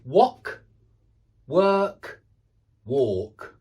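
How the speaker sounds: each word on its own, loud and drawn out; contrasting similar vowels. Shown as a demonstration. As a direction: pronounce wok, work, walk with three clearly different vowels. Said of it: "can you hear how different".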